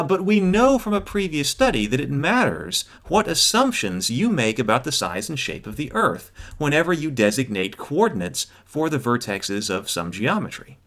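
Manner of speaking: ordinary delivery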